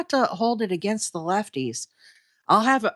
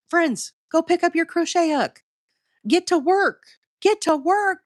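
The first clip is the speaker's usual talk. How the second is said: rousing pep talk; 8 semitones higher than usual. Real, not acted. Host rallying her live viewers, excited and raised. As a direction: fire up the chat to go make something.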